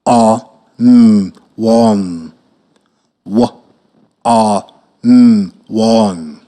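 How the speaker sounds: letters "W-O-N" stretched, then low "warm"; sounding out. Shown as a demonstration. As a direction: spell "W-O-N" slowly, then say "warm". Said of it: "Listen what I sound out the word".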